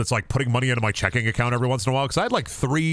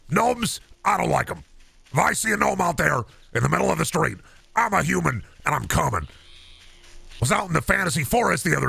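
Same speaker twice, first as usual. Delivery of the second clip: high, worked-up radio ranter; ranting conspiracy host; 5 semitones higher than usual. Acted. Host warning his listeners about gnomes, worked-up rant.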